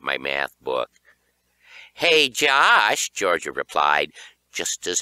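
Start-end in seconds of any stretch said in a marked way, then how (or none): 1.9-3.1 teasing call. Acted. Sister calling before throwing.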